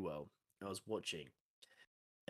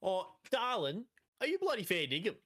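hammed-up outback TV Aussie caricature; broad Australian accent played for laughs; over-the-top Australian; 10 semitones higher, 5 dB louder than usual. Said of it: "over the top Australian".

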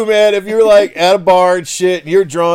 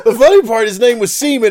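laughing; 8 semitones higher, 4 dB louder than usual. Real, not acted. Co-host laughing at the name.

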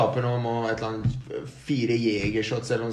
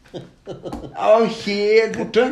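laughing; 7 semitones higher, 4 dB louder, much slower than usual. Retold or imitated. Describing being totally stoned.

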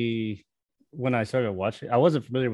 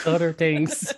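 higher, echoing Wisconsin twang; Wisconsin accent; 6 semitones higher, much slower than usual. Retold or imitated. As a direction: repeat it with a chirpy Midwestern lilt. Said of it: "Wisconsin accent".

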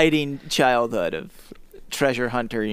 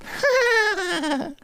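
high cackling ugly laugh; ugly villain laugh; 4 dB quieter than usual. Acted. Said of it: "gave an ugly laugh".

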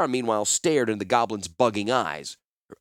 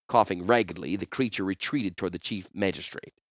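usual speaking voice

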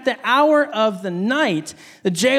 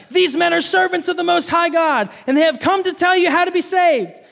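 put-on raised, higher reading of the girl's shout; 8 semitones higher than usual. A narrator's reading. Preacher reading the slave girl shouting after Paul, raised and high.

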